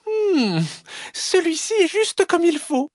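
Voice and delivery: very high little fox voice; contented sighing fox. Acted. Little fox settling in the small bed, contented squeak. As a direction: sigh happily in a tiny high voice. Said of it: "et soupire".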